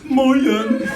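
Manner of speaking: high, loud dopey hello; hammed-up blaring greeting; goofy girl's greeting. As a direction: blurt it like the clueless suitor's match. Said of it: "finds another girl that goes".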